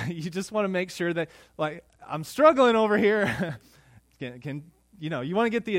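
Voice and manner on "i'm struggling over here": louder, higher mock complaint to the sleeping spouse; mock exasperation. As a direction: play up being the one who's struggling. Tired spouse back in bed.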